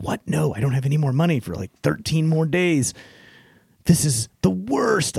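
half-joking dismay at an overdraft; mock panic. Dream panic over bank balance.

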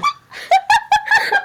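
high, loud real laugh; burst of laughter. Real, not acted. Reader cracks up at the story, high laughter. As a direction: crack up loudly, pitch shooting up.

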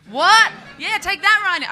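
high, loud jeer at the airline; mock triumph. Comic taunting Ryanair, high and loud triumph. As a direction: gloat like you scored a point.

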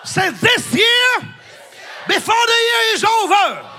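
very high, shouted declarations phrase by phrase; rousing preacher's cry, pitched high; full-force preaching. Real, not acted. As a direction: lead the congregation in a loud proclamation.

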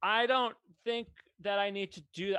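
usual speaking voice

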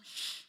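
sharp "shh" to silence someone; crowd's shush. Acted. Crowd silencing the blind man, shushing. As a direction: shush him as the crowd does. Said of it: "hushed him".

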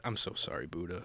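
soft joking apology to Buddha; hushed apology. Real, not acted. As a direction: whisper a sorry to the statue. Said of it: "apology to a Buddha head".

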